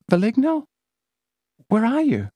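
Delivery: high startled question; scared girl. Acted. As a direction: look around in fear. Celia scared.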